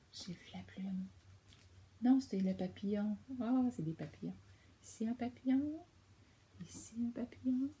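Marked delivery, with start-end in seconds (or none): 0.1-1.1 hushed secret; whisper. Real, not acted. The child tells the parent in a whisper. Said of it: "child whispers the find". 6.7-7.7 soft hushed repeat; whisper. Real, not acted. The child repeats it to the parent, whispering. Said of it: "repeat whispered".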